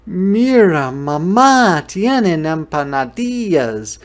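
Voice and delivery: high, loud, swooping shout; thrilled kid spotting a favorite treat; excited little boy. A narrator's reading. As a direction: call out to mom in delight. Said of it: "excited to see something else he recognized".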